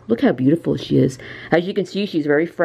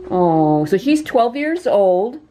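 ordinary delivery

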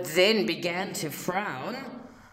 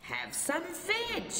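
hooted; 9 semitones higher, 8 dB quieter than usual. A narrator's reading. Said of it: "they hooted".

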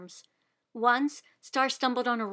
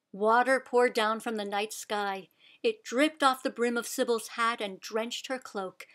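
usual speaking voice

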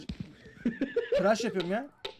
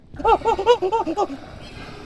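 panicked shriek; 12 semitones higher, 6 dB louder than usual. Real, not acted. Panicked in horror game.

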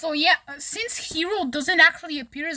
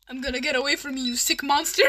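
ordinary talk, nothing marked

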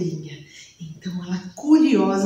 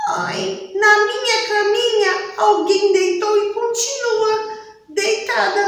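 much higher, excited cub voice; little bear voice; 10 semitones higher than usual. Acted. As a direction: squeak it like a surprised little bear. Ursinho acha menina na cama. (ursinho surpreso, voz bem aguda)

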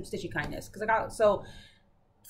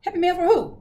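much higher and louder, hammed-up; imitating the friend's squeaky doubt; mock disbelief; 10 semitones higher, 7 dB louder, faster than usual. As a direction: play the friend who can't believe it's hers. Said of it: "She was like".

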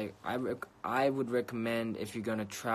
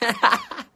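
burst of laughter; 15 dB louder than usual. Real, not acted. Shocked laugh at stream comment.

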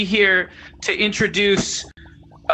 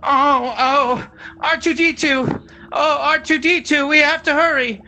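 high, fretful droid impression; urgent, higher-pitched droid voice; C three P O; 5 semitones higher than usual. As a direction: do a worried robot butler, pitched up. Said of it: "C three P O impression".

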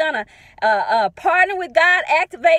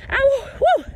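praise whoop; 9 semitones higher than usual. Real, not acted.

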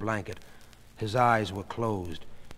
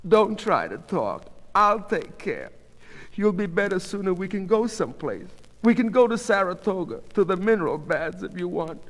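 Goldie, anxiously reassuring wife; 9 semitones higher than usual. A narrator's reading. Goldie planning for her stricken husband, anxiously reassuring.

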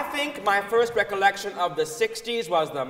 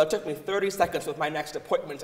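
ordinary talk

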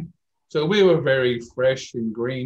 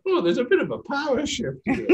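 much higher, amused Irish aside; gleeful realization; 12 semitones higher than usual. Retold or imitated. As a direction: savour the discovery with a grin. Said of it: "I was going".

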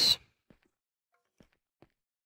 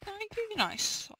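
sarcastic thanks; 10 semitones higher, 12 dB quieter, slower than usual. Real, not acted. Sarcastic thanks for a lousy item.

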